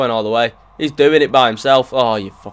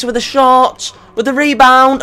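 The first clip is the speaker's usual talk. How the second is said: higher and louder commentator call; building commentary; 11 semitones higher, 7 dB louder than usual. Acted.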